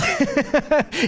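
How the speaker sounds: short real laugh; pleased laugh. Real, not acted. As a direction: laugh briefly in approval of the answer. Amused after congregant's correct answer.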